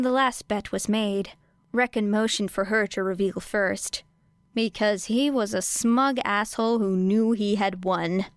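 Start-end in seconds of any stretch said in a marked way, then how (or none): none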